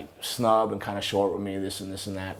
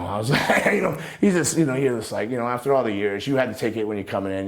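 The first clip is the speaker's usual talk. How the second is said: ordinary delivery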